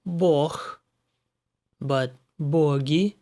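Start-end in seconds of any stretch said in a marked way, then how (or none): 0.0-0.8 бог with г said as х at the end; бог as бох. Shown as a demonstration. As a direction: say бог ending in х. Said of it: "The exceptions are".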